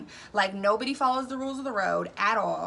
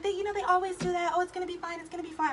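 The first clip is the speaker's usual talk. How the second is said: dismissive friend; 8 semitones higher, faster than usual. Retold or imitated. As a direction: wave his worry away lightly. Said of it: "She's like".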